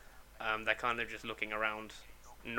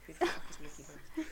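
patron voice; 3 semitones higher, 7 dB quieter, much faster than usual. Acted. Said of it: "One of the patrons says".

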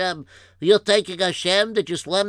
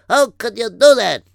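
loud rebuke; 3 semitones higher, 8 dB louder than usual. Acted. Scolding him for slamming door.

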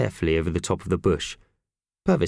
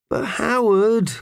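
disappointed; 4 dB louder, slower than usual. A narrator's reading. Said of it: "chorused the mice disappointedly".

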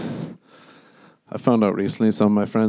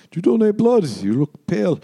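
higher, laughing mock voice; mocking the guy; 9 semitones higher than usual. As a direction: tease like a nosy stranger, higher. Mocking guy asking about blood.

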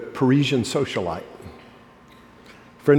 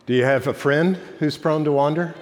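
usual speaking voice

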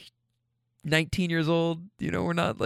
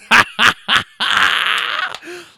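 loud, high staccato cackle; high, drawn-out victory cackle; gloating laugh; 9 semitones higher, 7 dB louder than usual. Real, not acted. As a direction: crow with a big pulsing laugh. Crowing after announcing the score.

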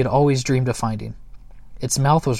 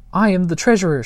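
high, loud and self-important; importantly; 7 semitones higher, 6 dB louder than usual. A narrator's reading. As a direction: announce your grand title. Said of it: "she said importantly".

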